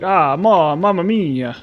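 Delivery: a hint of hammed-up Italian accent; mock Italian. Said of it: "pretend to be Italian".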